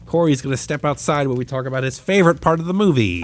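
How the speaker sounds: play-by-play like a cheap Vin Scully; mock sportscaster. Friend hamming up the baseball commentator for the podcast. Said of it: "a discount Vin Scully who is commentating this".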